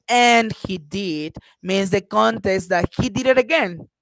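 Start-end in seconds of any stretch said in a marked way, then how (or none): 0.1-1.4 slow, with "and" pushed out first; stress on and. Shown as a demonstration. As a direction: hit "and" in "and he did," slowly. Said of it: "so if I stress".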